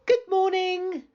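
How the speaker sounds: put-on high, bright little girl voice; high-voiced girl calling good morning. A narrator's reading. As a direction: greet him as a cheerful young girl.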